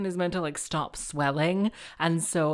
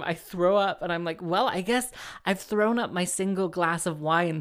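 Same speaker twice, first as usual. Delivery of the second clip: ordinary delivery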